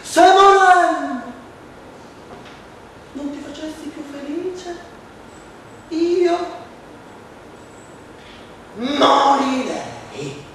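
high, slow theatrical mother's plea; melodramatic mother. Acted. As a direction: play the dying mother for pity. (madre melodrammatica, acuta e lenta)

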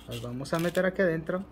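ordinary talk

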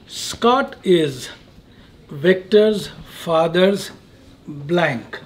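measured, pausing between each phrase; deliberate quizmaster reading, dropping off on "blank"; slow riddle delivery. Real, not acted. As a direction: lay out the sentence piece by piece. Host poses a fill-in-the-blank riddle.

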